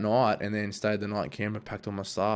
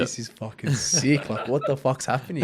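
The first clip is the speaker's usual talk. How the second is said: slow, drawn-out words breaking into a laugh; amazed laughing; 4 semitones higher, slower than usual. Retold or imitated. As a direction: savour the disbelief. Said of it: "I was like".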